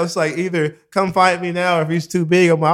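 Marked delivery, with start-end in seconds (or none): none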